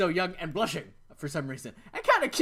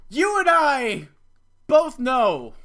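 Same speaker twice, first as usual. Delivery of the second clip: louder, higher mock-grave warning; dramatic to viewers; 6 semitones higher, 9 dB louder, much slower than usual. Real, not acted. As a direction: tell the audience the doom you know.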